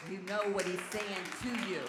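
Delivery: loud, punched-out preaching; emphatic repeat. Real, not acted. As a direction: drive every word home to the congregation.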